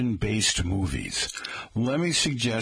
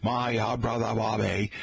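patrician Kennedy-style Boston impression; mock-presidential Kennedy Boston speech; Kennedy Boston accent. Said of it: "maybe a Kennedy Boston accent".

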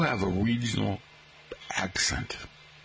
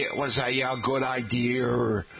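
put-on Kennedy accent. Professors mocking an added R, Kennedy accent. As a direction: add an R to idea, Kennedy-style. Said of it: "don't add R's".

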